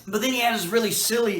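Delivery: normal talking voice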